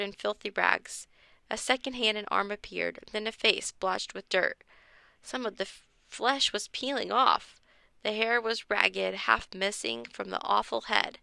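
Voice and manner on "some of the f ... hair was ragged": usual speaking voice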